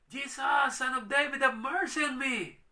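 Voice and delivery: loud, high cry for mercy; a desperate woman crying out to Jesus, loud and high; pleading cry. Acted. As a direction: call out to Jesus with everything.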